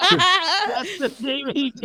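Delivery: loud, high, slow, through laughter; laughing callback. Real, not acted. Friend calling back the DVD joke, laughing loudly. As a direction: crack up while crowing the punchline.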